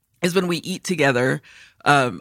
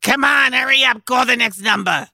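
higher-pitched mock shout of an elderly bingo player; imitated impatient yelling; 7 semitones higher, 3 dB louder than usual. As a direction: holler like an impatient old woman at bingo. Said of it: "she's always yelling at the activities lady".

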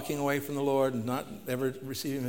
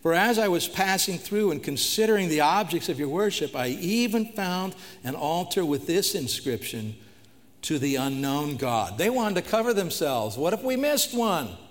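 raised, ringing pulpit voice; preaching at full force; 5 semitones higher, 5 dB louder than usual. Acted. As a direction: proclaim it to the whole hall. Preaching the Athens sermon aloud.

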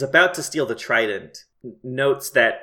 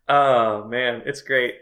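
ordinary talk

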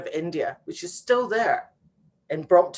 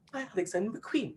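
husband's inner lament; 3 semitones higher, 12 dB quieter than usual. Retold or imitated. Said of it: "he was thinking".

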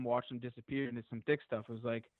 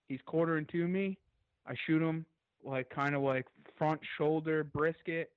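ordinary delivery

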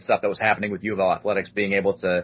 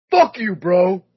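higher, louder jeer; mock heckle; 7 semitones higher, 6 dB louder, slower than usual. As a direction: heckle him. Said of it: "This guy just goes".

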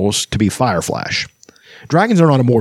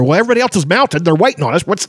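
excited kender; 7 semitones higher, faster than usual. Retold or imitated. Kender arguing with Flint.

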